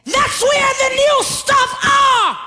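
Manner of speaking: high, shouted, slowed preaching; preaching at full force. Real, not acted. Preacher urging the congregation to reach back, full-force preaching. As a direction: let it ring out over the church. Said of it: "why am I speaking like this today".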